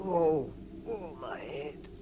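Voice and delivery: dazed groan. Acted. Bilbo waking with a head wound, groaning. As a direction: come round hurt and woozy. Bilbo wakes after a knock.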